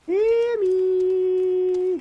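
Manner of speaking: eerie high call, drawn out; creepy drawn-out call. Pretend. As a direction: call her name like a ghost. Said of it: "that creepy, Cammy?".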